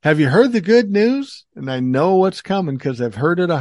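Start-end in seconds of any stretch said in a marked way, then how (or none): 0.0-1.4 scammer pitch. Retold or imitated. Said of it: "They'll say".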